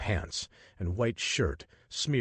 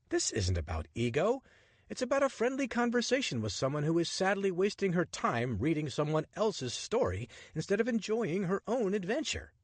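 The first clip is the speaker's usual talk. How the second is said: lightly voiced charming rogue, higher and playful; cheeky adventurer; 12 semitones higher than usual. Richard flirting with the woman on the Nile train, playful and cocky. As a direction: tease her with easy confidence.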